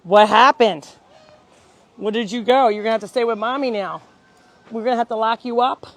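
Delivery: loud, fast scolding of a runaway; loud mock scolding. Real, not acted. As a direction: tell him off, half joking. Scolding the dog.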